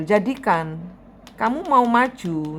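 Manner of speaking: usual speaking voice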